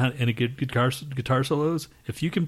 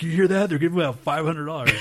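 excited guitar player; 7 semitones higher, 5 dB louder than usual. Retold or imitated. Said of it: "All these guitar players, like".

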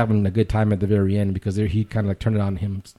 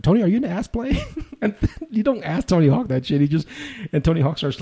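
higher, cracking up while quoting the question; can't hold back the laugh; laughing through it; 10 semitones higher than usual. Real, not acted.

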